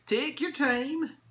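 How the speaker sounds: high, slow elf voice; encouraging elf. Acted. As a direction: pipe it up, drawn out. Elf urges boy, first take.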